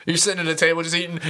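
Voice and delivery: ordinary talk, nothing marked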